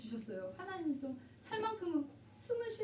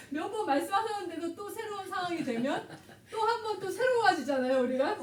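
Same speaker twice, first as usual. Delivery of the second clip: speaks through laughter, pitched up and louder; amused at her own forgetfulness; laughing; 7 semitones higher, 5 dB louder than usual. Real, not acted. Telling listeners she forgets God's promises, laughing.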